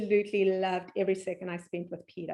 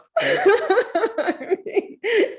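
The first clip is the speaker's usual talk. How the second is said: high, loud burst of laughter; real laughter; 14 semitones higher, 7 dB louder than usual. Real, not acted. Host reacts to story.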